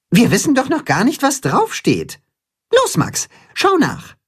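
hoch und zügig, drängend; Timme fordert auf. A narrator's reading. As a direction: treib den Freund ungeduldig an. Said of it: "fordert Timme seinen Freund auf". (Timme drängt Max, hoch und schnell)

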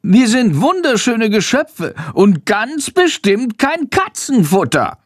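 very high, impassioned bat; high-pitched protest, slowing for emphasis at the end. A narrator's reading. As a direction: exclaim indignantly in a high bat voice. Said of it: "fügt mit erhobener Stimme hinzu".

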